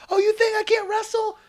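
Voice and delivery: very high, taunting imitation of Ronda; hammed-up Ronda mocking the crowd; mock Ronda yelling. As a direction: squeal a taunt at the fans. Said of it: "starts yelling at the fans, saying".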